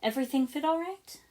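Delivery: high, loud, bright question; brightened. Acted. Coco asking Lloyd about his new clothes, brightly. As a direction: perk up with eager hope. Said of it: "Coco immediately brightened and said".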